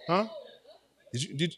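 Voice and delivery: normal talking voice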